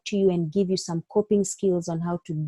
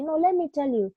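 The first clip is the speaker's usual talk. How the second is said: higher-pitched insistent doctor talking over the patient; the wrong way, cutting the patient off; impatient doctor butting in, as a bad example; arguing back; 8 semitones higher than usual. Shown as a demonstration. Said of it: "Don't say".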